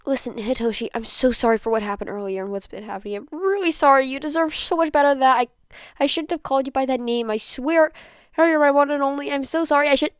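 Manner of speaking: high, loud, tearful pleading; tearful apology. Acted. Denki apologizing tearfully to his boyfriend Hitoshi, high and pleading. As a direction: beg forgiveness through rising tears. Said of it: "partly to hide tears".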